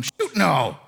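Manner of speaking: a lightly voiced folksy American country speaker, not English at all. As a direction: say it like a relaxed rural American, not a proper Englishman. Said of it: "Proper English people don't talk like that".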